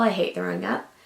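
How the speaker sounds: ordinary talk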